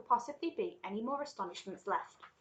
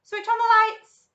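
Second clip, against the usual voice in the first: very high, drawn-out shout; shouted command; 14 semitones higher, 17 dB louder, slower than usual. A narrator's reading. Wonka orders the lights on, shouting. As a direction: bellow the order down the tunnel. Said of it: "shouted Mister Wonka".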